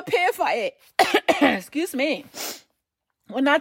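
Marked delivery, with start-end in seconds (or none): none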